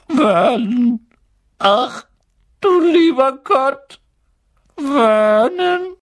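high, loud, aghast cries; choking horror. A narrator's reading. Aunt reads the letter and calls husband, horrified. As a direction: cry out high as if about to faint. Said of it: "gab ein würgendes Geräusch von sich".

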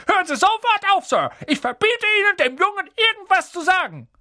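hoch, laut und herrisch; aufgebrachter Onkel Vernon. A narrator's reading. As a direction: verbiete es ihm schrill. Vernon verbietet Hagrid das Reden. (Onkel Vernon verbietet Hagrid zu reden, herrisch)

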